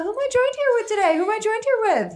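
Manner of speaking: very high-pitched singsong question; quick high chirp handing over to the co-host; playful cue. Pretend. Host cues her co-host to introduce himself, playful high cue.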